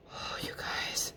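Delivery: hushed unvoiced whisper. Real, not acted. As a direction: whisper it as a quick aside. Aside while recording stops.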